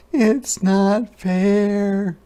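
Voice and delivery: high, very slow whiny cry; high slow whining hedgehog; upset hedgehog. A narrator's reading. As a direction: drag it out in complaint. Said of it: "cried Hedgehog".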